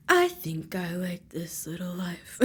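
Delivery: soft, private whisper; whispered aside. Real, not acted. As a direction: whisper it like a secret admission. Confiding aside to viewers.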